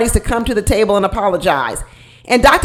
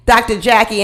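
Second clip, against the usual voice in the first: ordinary talk, nothing marked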